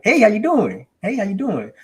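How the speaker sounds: high, bubbly phone greeting; sounding like a kid. Retold or imitated. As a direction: imitate an over-eager youngster cold-calling. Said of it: "you're sounding too much of, of, of a, like a kid".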